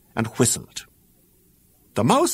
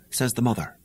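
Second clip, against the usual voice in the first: ordinary delivery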